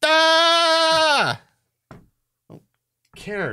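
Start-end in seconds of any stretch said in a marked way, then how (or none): 0.0-1.4 long high loud exasperated cry; frustrated yell. Real, not acted. Sent back on the board, fed-up screaming.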